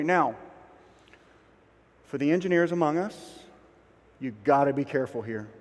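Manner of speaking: ordinary delivery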